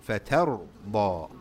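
Fatarda slowly with a dark, full r and da; corrected heavy ra. Shown as a demonstration. Teacher corrects the mistake, slow heavy ra.

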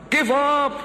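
acted yell of discouragement. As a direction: call out urgently to someone down a well. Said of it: "the friends start yelling".

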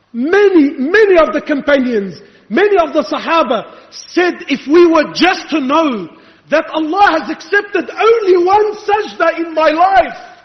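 high, forceful sermon delivery; impassioned preaching. Real, not acted. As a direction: drive each phrase home to the congregation.

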